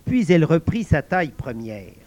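ordinary delivery, nothing marked

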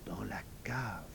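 soft whispered confession; hushed, voiceless little admission; murmur. A narrator's reading. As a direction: whisper it timidly. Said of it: "murmura Mlika".

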